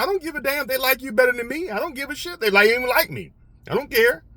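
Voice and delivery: high-pitched acted protest; defiant retort. Worker telling his manager he doesn't care, high-pitched defiant retort. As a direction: push back insistently with the voice way up.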